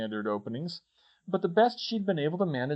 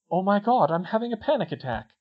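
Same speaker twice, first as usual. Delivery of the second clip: higher-pitched inner alarm; panicked thought; 7 semitones higher, 4 dB louder than usual. A narrator's reading. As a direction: realise it with mild panic. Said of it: "part of her brain was thinking".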